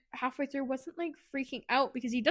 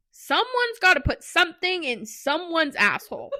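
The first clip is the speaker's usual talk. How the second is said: laughing; 5 semitones higher, 4 dB louder than usual. Real, not acted. Laughs mid-line.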